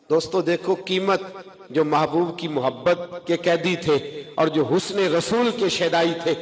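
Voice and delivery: ordinary talk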